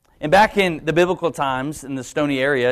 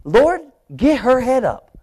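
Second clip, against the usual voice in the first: slow, higher, impatient prayer; prideful prayer; 8 semitones higher, 3 dB louder, slower than usual. Retold or imitated. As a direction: demand it of God slowly. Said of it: "So I finally just said".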